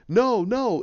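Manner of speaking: high acted refusal; Banner. Banner refusing Rocket, high mock protest. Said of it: "He goes".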